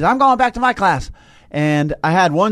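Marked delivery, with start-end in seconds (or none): 0.0-1.1 put-on higher, louder defiant child; defiant kid voice. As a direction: announce it like a stubborn kid. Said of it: "but he's".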